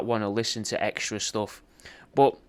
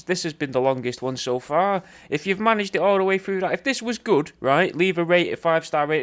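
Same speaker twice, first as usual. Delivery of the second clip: ordinary talk, nothing marked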